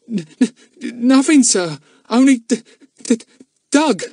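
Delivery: put-on scared boy tripping over words; frightened stammer. A narrator's reading. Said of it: "he stammered".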